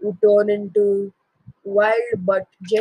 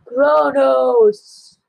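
loud proud shout of his name; 5 semitones higher, 6 dB louder than usual. Acted. Kronos declaring his name to father, loud shout. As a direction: announce yourself with a yell.

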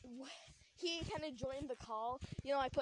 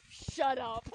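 louder, cold curt shut up; passive aggressive; 6 dB louder than usual. Retold or imitated. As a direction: snap it short, loud and cold. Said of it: "so passive aggressive".

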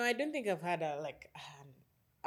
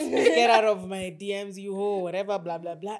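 laughing retold insult; 2 semitones higher, 14 dB louder than usual. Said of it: "he said".

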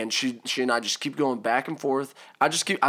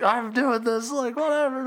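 retold rage; 11 semitones higher than usual. Stepmom refusing to leave the car, mock raging. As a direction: imitate her fed-up defiance up high. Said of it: "in a rage".

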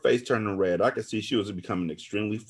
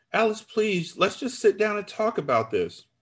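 high, imploring voice; pleading; 12 semitones higher than usual. A narrator's reading. Narrator begs friend to talk it over, pleading.

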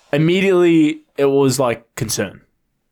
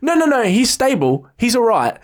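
higher-pitched imitation of her; retold girlfriend reassuring; 8 semitones higher than usual. Girlfriend to worried friend on the phone, imitated reassurance. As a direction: hurriedly reassure in a lightly put-on voice. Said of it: "And then she goes".

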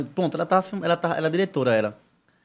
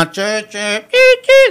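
imitando o "tchê, tchê" da máquina, subindo agudo e forte; barulho de máquina; 6 dB louder, much slower than usual. Retold or imitated. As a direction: faz o som mecânico com a boca. Imitates the machine working. (onomatopeia de máquina trabalhando)

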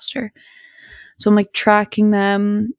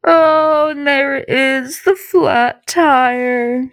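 dramatic reveal; 5 semitones higher, much slower than usual. Retold or imitated. Dramatic reveal of flat tire.

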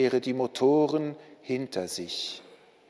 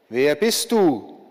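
slow, louder call into the distance; calling out again; 5 dB louder than usual. A narrator's reading. War calls out to the approaching stranger, slow and loud. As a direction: call out slowly, demanding an answer. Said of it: "rief der Krieg wieder".

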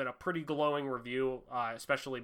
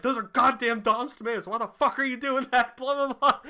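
louder, higher mock rant, swearing at the shooter; hammed-up furious outburst over ruined tomatoes; imitating a raging soldier, higher and louder; angry rant; 8 semitones higher, 5 dB louder than usual. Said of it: "he goes on this big, long fucking rant".

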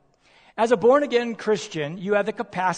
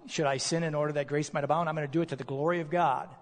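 mock arguer; 4 semitones lower, 6 dB quieter, faster than usual. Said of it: "Paul would say to those who argue".

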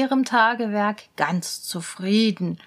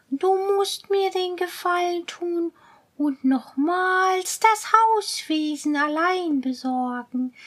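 higher, pleading cat voice; coaxing cat; 7 semitones higher than usual. Acted. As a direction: sweet-talk like a scheming cat. Cat asks favour.

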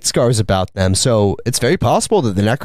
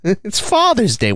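mock indignant; 7 semitones higher than usual. Acting indignant father refusing to pay.

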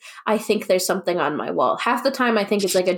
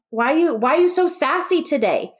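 high, mock kid voice asking; 7 semitones higher than usual. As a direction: imitate a curious kid, pitched up. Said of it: "someone always goes".

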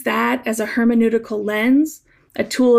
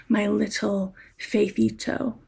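much softer, fond and tender; endearing; 7 dB quieter than usual. Retold or imitated. God reassuring a worried believer, soft and fond. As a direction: murmur it like a pet name. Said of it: "term of endearment".

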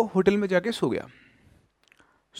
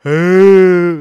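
a sudden loud eerie cry, louder and in an unfamiliar voice; ghostly wail; 12 dB louder than usual. Retold or imitated. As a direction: burst out with the spirit's shrieking wail. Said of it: "वो आवाज़ एकदम से change हो गई". (अचानक ज़ोर की डरावनी चीख)

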